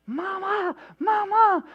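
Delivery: put-on high, loud terrified child's cry; retold child's scream. Preacher imitating son screaming for mom at night. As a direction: shriek for mom like a scared kid. Blood-curdling scream from the other side.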